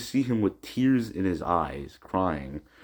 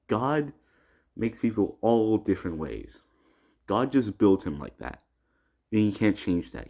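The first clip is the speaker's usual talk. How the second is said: ordinary talk, nothing marked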